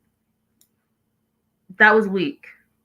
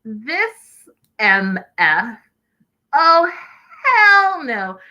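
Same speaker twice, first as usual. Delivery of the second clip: slow build to a high, loud hell no; outraged yell; 6 semitones higher, 4 dB louder, much slower than usual. Retold or imitated. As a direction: disbelief turning to a yell. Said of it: "I was like".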